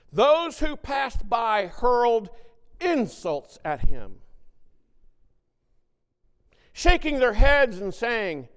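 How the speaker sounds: loud, high, impassioned scripture reading; preaching at full force. Acted. Preacher to his congregation, loud and impassioned. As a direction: proclaim the passage like a preacher. Preaches the crucifixion text aloud.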